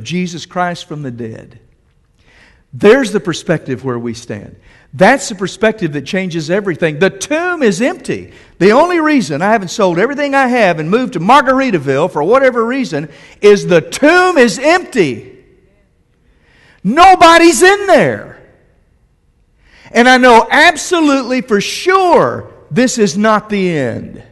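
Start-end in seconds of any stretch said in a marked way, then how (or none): 2.7-15.4 louder, faster fervent preaching; preacher proclaiming the empty tomb; full-force preaching. Real, not acted. 16.8-18.4 high, loud and slow cry; preacher crying out that the tomb is empty; triumphant shout. Real, not acted. 19.9-24.2 loud, high proclaiming; preacher declaring it is not the end; fervent preaching. Real, not acted. Preaching at full force.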